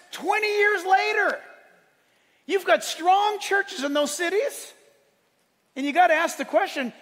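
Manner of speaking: high, loud, driving sermon delivery; loud, emphatic preacher; full-force preaching. Acted. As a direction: preach it out to the whole church.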